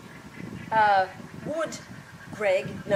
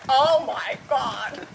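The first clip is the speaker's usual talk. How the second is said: drawn-out, dismayed reaction; stunned exclamation; 3 semitones higher, 4 dB louder, much slower than usual. Retold or imitated. Listener dying at what Al has just said, stunned exclamation. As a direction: stretch it out as if dying inside. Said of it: "Oh, I'm dying here. I'm going".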